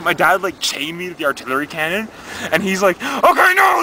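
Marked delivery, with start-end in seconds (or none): 3.0-3.8 high, loud shout; dad yelling. Acted. Dad at the cannon before firing, yelling. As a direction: holler it high, launching into action.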